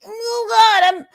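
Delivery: high, loud, hammed-up apology with a little cry; mock panicked apology. Actress grovelling in fake panic to the director. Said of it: "if you were like".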